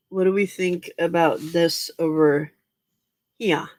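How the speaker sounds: ordinary delivery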